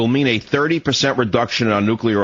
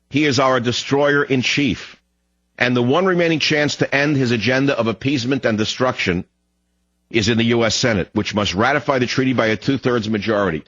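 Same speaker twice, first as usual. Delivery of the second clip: ordinary delivery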